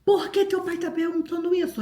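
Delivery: high-pitched imitation of mom demanding an answer; alarmed mother. Mother confronting her son about dad's question, high alarmed imitation. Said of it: "minha mãe veio igual um foguete".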